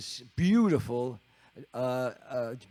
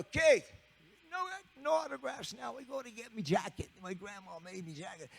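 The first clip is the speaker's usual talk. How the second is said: lightly British, high and insistent; high English drummer; 9 semitones higher than usual. Retold or imitated. Keith insisting he must get his grandma's jacket, high English voice. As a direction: push past with a plea. Narrator voicing Keith again.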